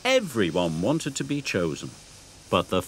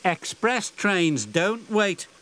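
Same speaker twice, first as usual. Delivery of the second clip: slow, high, haughty engine; 9 semitones higher, slower than usual. Acted. Emily answers James, slow and haughty. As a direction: state it slowly and superior. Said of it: "said Emily".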